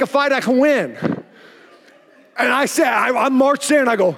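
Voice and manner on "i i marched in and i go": ordinary talk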